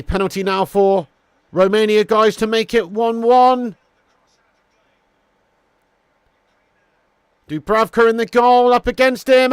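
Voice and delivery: loud, excited goal call; projected play-by-play, building to the goal and easing; live football commentary. Real, not acted. Commentator calling Romania's equaliser for viewers, loud and excited.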